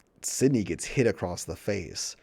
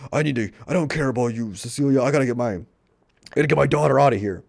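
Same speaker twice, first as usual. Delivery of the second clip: louder, fast frantic rush; hurrying to get his kid out; mock panic; 5 semitones higher, 7 dB louder, much faster than usual. [name] telling Cecilia he must take his daughter away, mock panic.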